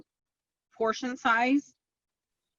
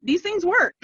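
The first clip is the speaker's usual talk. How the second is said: excited; 10 semitones higher, 5 dB louder than usual. Retold or imitated. Excitedly showing off her photos.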